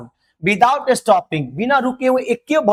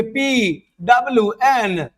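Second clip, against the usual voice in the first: slow letter-by-letter spelling; spelled out; much slower than usual. Shown as a demonstration. Teacher showing wrong English spelling, slowly letter by letter. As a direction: spell it slowly, each letter held apart. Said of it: "English में क्या लिख देंगे".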